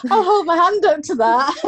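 laughing while owning it; loud, high, giggly confession. Real, not acted. Laughing admission of own fear.

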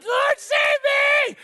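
high, drawn-out panicked yell, hammed-up. Preacher with no income or plan, mock-panicked yelling. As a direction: yell slowly and desperately for rescue. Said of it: "I start yelling".

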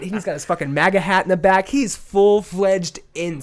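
hyped up describing the video; excited. Real, not acted. Excited telling, loud high.

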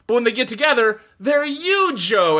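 pun punchline. Retold or imitated. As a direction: stretch Yu-Jo out, way up high. Pun punchline in retold joke.

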